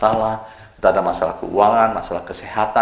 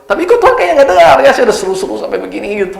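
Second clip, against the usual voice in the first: much higher, loud complaint; high exasperated whine; 12 semitones higher, 6 dB louder than usual. Pretend. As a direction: whine that God isn't listening. (keluhan merengek, jauh lebih tinggi)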